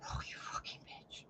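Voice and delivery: hushed, unvoiced insult; whisper. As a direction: whisper it under your breath. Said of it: "I'm like".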